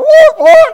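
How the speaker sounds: high yelping whimper of a struck dog. Retold or imitated. Beaten guard dog yelps and slinks away. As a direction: yelp like a hit dog. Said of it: "and that dog goes".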